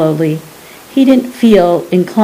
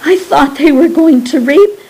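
wailing; 8 semitones higher than usual. Acted. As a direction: wail it out in distress. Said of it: "Rosie wailed".

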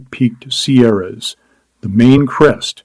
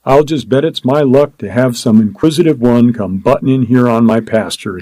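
plain reading voice

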